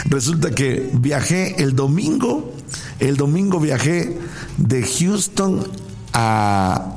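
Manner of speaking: usual speaking voice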